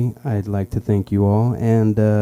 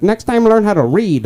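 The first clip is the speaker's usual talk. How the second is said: high-pitched parting jab; scolding passenger; 10 semitones higher, 4 dB louder than usual. Acted. Lady passenger dropped off by driver, scolding. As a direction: act a lady telling off her driver.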